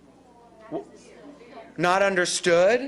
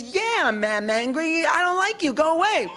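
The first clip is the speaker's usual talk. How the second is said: angry patient; 7 semitones higher than usual. Retold or imitated. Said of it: "The alternative is".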